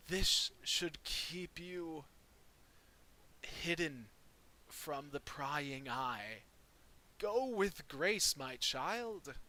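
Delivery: put-on slow, croaking old priest; lightly voiced old man blessing. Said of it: "I don't know why he's got an old man voice".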